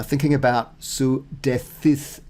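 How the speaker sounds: ordinary delivery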